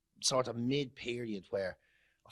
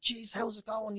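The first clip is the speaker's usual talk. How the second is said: high, surprised hello; recognising the nurse with surprise; greeting the nurse, high and surprised; 9 semitones higher than usual. Retold or imitated. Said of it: "I said".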